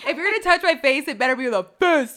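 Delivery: loud, high playful fighting talk; mock threat. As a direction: threaten a brawl, breaking into laughter.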